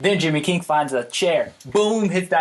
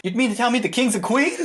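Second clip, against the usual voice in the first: amazed, laughing; 6 semitones higher than usual. Retold or imitated. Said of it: "Gordy's like".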